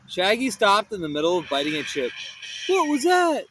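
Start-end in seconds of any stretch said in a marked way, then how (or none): 2.7-3.5 scared. Acted. Hearing a creak.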